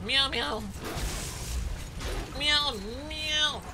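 quick run of playful meows; cat meowing. Pretend. Player meowing along during the game, playful cat chatter. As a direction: chatter away in repeated little meows.

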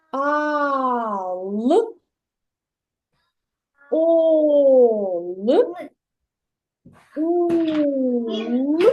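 each syllable «Ал», «ол», «ул», «іл» stretched long and clear; drawn-out syllables. Shown as a demonstration. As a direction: read each syllable slowly with a pause. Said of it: "Давай будемо читати склади". (протяжне читання складів «ал», «ол», «ул», «іл»)

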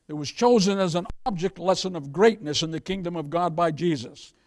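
ordinary delivery

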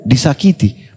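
disakiti set off by a pause and a falling tune; pause, then hit disakiti; stress on disakiti. Shown as a demonstration. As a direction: disakiti singled out after a pause. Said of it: "Garis bawahi kata-kata disakiti".

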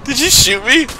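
high, loud startled shouting; shocked yell. Real, not acted. Suddenly hit in the game.

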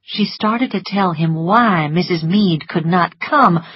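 ordinary delivery, nothing marked

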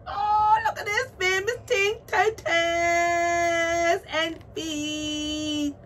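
high, loud excited squeal; squealing excitement. Real, not acted. Collector squealing over her doll's famous feet, excited squeal. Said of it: "I just feel super excited".

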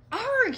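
loud, high "argh"; startled shout. A narrator's reading. Sid falls into a huge hole, loud high yell. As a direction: cry out high as if falling. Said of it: "he shouted".

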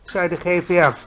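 ordinary delivery

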